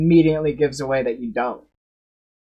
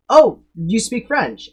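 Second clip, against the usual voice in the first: higher, louder, slowed mock surprise; surprised question; 6 semitones higher, 5 dB louder, slower than usual. French speaker meets someone claiming the language, surprised question. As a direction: act pleasantly surprised, drawing it out. Real speaker confronts the bluffer.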